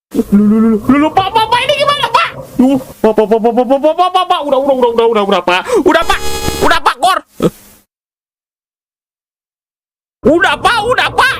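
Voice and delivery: terrified high pleading to the bapak to stop; panicked shriek. Real, not acted. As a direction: squeal in real panic as the monster appears. Still panicking, begging to stop.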